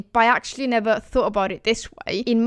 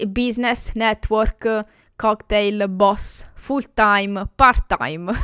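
English loanwords said the Italian way; Italian pronunciation; slower than usual. Shown as a demonstration. Showing learners how Italians say English loanwords, Italian accent. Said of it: "pronounce them in an Italian way".

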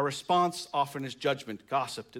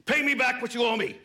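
loud, high angry demand; raised voice, demanding repayment; forceful demand; 9 semitones higher, 7 dB louder than usual. A narrator's reading. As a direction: shout it like a creditor collecting. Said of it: "he demanded".

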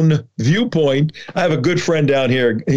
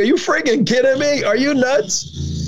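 pitched way up in disbelief, dragging out the nuts; mock outraged residents; 11 semitones higher than usual. Residents rejecting the petition, in high incredulous disbelief. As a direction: scoff like a resident hearing something absurd. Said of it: "They go".

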